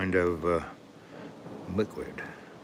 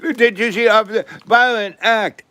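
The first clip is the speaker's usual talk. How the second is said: high, louder squeaky tattletale voice; mocking tattling kid; 12 semitones higher, 6 dB louder than usual. The kid tattling to the cop, high mocking whine. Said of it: "And the kid goes".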